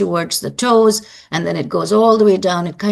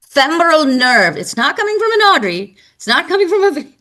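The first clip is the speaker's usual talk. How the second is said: higher-pitched, insistent correction of students; emphatic correction; 7 semitones higher, 3 dB louder than usual. Real, not acted. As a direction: correct the wrong guesses emphatically, pitch jumping up. Correcting students' wrong guesses.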